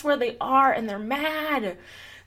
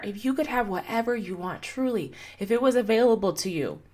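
usual speaking voice

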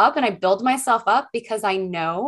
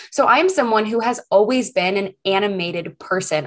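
ordinary talk, nothing marked

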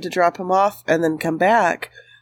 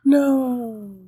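sharp horrified gasp, then no; shocked gasp; 6 semitones higher than usual. Real, not acted. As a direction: gasp in alarm. Hears about roadkill.

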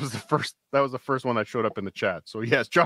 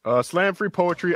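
normal talking voice

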